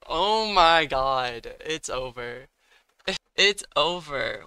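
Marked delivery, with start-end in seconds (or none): 0.1-1.6 slow, loud groan of disbelief; stretched out and loud; exasperated oh my god. Real, not acted. Bad turn in the battle. 3.4-4.4 loud, slowed, falling it's over; louder, drawn-out lament; despairing cry. Real, not acted. Losing the battle.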